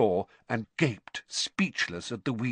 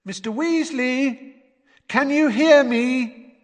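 high, slow, loud call; calling through a wall; 13 semitones higher, 6 dB louder, slower than usual. A narrator's reading. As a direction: stretch the words to carry through boards.

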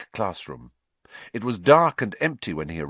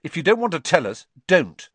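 snapping; 9 semitones higher, 6 dB louder than usual. A narrator's reading. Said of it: "snapped Ron".

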